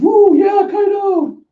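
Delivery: very high, loud whooping; crowd cheer. Acted. Crowd cheering Kaido onstage, high loud whoop.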